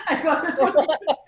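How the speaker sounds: words broken up by laughter, pitched high; laughing. Real, not acted. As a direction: laugh through the line. Laughing at his own naivety.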